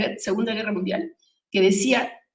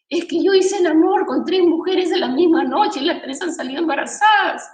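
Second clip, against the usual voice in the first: higher, louder upset confession; imitating distraught friend; 7 semitones higher, 6 dB louder than usual. Friend confesses to Dalila, higher and distressed. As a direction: blurt it out like a panicked friend. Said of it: "viene llorando y me dice".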